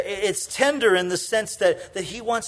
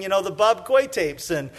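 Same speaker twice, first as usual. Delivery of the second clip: ordinary talk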